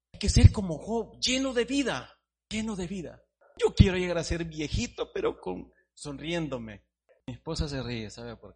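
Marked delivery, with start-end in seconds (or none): 0.1-6.8 high, loud preaching. Acted. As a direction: proclaim it to the church. Preacher exhorting congregation.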